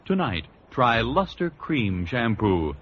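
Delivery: ordinary delivery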